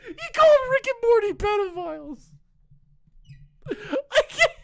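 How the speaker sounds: loud, very high squeal of laughter; helpless laughter. Real, not acted. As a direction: shriek it out while cracking up. Cracks up at the line.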